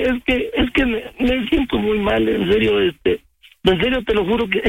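ordinary delivery

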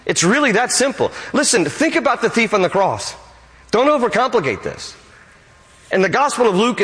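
high, fervent preaching voice; full-force preaching. Acted. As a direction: drive the point home from the pulpit.

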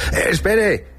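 high-pitched cry to stop; calling after someone. Acted. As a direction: call out urgently as you run after him. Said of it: "le grité corriendo tras él".

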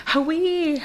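Hawaii said high as a Kwakwala "I'm cold"; Kwakwala cold word. Retold or imitated. Standing cold among friends, a high Kwakwala Hawaii. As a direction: pitch Hawaii way up like a shiver. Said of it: "I'm like".

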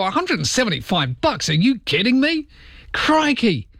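high-pitched, mock-incredulous reaction; outraged disbelief. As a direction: echo the amount as if appalled. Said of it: "you go".

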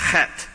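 "chet" with a guttural ch and a hard T at the end; "chet", throaty ch into a hard T; hard final T. Shown as a demonstration. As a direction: finish "chet" on a crisp T, not a th. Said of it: "the T H is actually a hard T".

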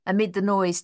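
ordinary delivery

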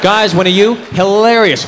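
usual speaking voice

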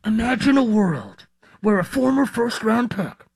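dramatic trailer narrator voice; movie trailer voice. Shown as a demonstration. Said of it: "I'm not really the movie trailer voice type of guy".

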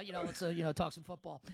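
ordinary talk, nothing marked

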